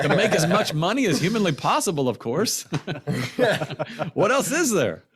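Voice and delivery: high, animated mock-obvious answer; cocky retort. College sophomore answering the commandant on success, cocky retort.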